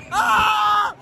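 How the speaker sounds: high thrilled scream "Ah"; ride scream. Real, not acted. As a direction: let out a high ride yell. Cri sur le manège. (cri aigu de manège)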